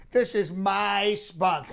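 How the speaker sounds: normal talking voice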